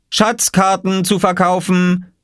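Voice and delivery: put-on slow, high child calling his wares; drawn-out boyish sales call. A narrator's reading. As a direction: hawk the maps like a market crier. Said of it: "rief Oskar".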